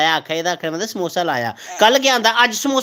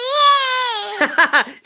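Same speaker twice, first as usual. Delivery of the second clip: 1 high laugh. Real, not acted. Laughs at child's answer.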